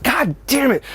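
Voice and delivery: very high yelp of god damn it; high sudden cry of pain; pained outburst. Retold or imitated. Cat bites and scratches him.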